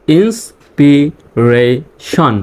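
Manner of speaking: Inspiration very slow, in four separate parts; syllable by syllable. Shown as a demonstration. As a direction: split inspiration into ins, pi, re, shon with pauses. Teacher breaks word into syllables. (Inspiration ভেঙে ভেঙে, খুব ধীরে)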